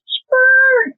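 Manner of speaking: high-pitched squeaky sound effect; imitated mailbox noise. Mother retelling the late-night gift drop, imitating mailbox squeak. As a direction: mimic a creaky mailbox, high and drawn out. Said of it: "and it goes".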